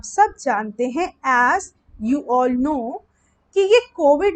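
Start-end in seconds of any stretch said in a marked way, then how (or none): 1.6-3.0 stretched out word by word; very slow model answer; slow dictation. Shown as a demonstration. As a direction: model the phrase slowly for students to write. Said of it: "इसको English में कैसे बनाएंगे?".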